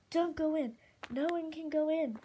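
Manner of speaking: high, loud, urgent warning; desperate cry. A narrator's reading. Moon blocks her friends from the cave, desperate cry. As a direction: cry out to keep them all back. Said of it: "Moon cried".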